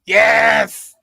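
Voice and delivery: loud, high shout of triumph; sudden burst of relief and glee; excited shout. Real, not acted. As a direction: yell it like you finally got the answer. Finally remembers the streamer's name.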